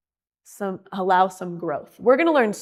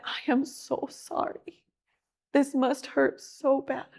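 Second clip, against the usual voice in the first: tender comfort; 4 semitones higher, 6 dB quieter, slower than usual. Acted. Comforting a grieving woman.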